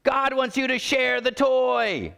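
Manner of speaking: high, slow exasperated parent yell; drawn-out parental shout; mock yell. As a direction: call it across the room, stretched out. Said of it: "when you yell across the room".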